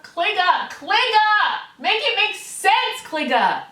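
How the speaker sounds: loud, high, incredulous outburst; exasperated yell. Real, not acted. Demanding the commenter explain herself, exasperated yell. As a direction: shout it like a desperate demand. Pleading rant builds to yelling.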